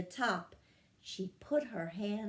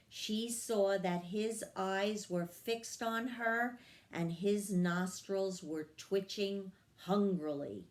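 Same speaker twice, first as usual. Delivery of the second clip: ordinary delivery, nothing marked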